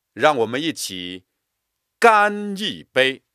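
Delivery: 干一杯 shouted high and loud; stress on 干一杯. Shown as a demonstration. Teacher demonstrating a toast, 干一杯 loud. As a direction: raise the toast, punch 干一杯. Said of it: "加重力度和音强".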